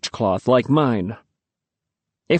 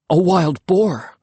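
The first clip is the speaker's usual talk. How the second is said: slow, stretched alarm at the boar; alarmed cry; 5 semitones higher, slower than usual. A narrator's reading. Narrator realizing the danger, slowly alarmed. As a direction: dread building on boar.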